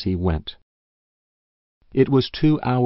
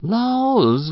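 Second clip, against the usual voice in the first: a high rising cry of laws; old farm wife's high startled cry; 14 semitones higher, 4 dB louder than usual. Acted. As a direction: gasp it out up high in dismay. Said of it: "sighed Missus Race".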